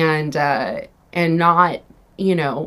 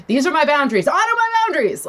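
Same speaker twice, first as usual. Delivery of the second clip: high, hammed-up proclamation, rising to very high at the end; mock performative announcement; 7 semitones higher than usual. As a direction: parade your new boundaries like a sign-waver. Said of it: "this really big performative thing".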